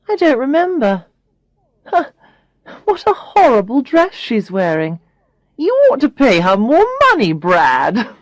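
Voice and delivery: a hint of a high, catty girlfriend; lightly voiced snooty Tanya. Tanya mocks Alex.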